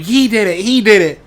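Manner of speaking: put-on high, loud childish accusation; kids tattling. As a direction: point and tattle like little kids. Little kids pointing at him.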